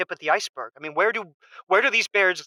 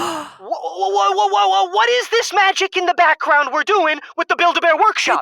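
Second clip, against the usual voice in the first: stuttering, rising, louder and higher; flustered, higher, stammering outburst; panicked stammer; 8 semitones higher, 5 dB louder than usual. Acted. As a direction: sputter in disbelief, climbing into a yelp. Husband panics over hidden bears.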